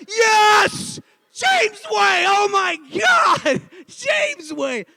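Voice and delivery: loud, high, drawn-out shouts of triumph; ecstatic crowd cheering. Retold or imitated. Crowd leaping up in ovation, ecstatic shouting. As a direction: yell it like a roaring crowd.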